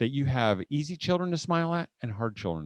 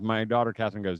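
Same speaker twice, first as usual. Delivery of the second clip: ordinary talk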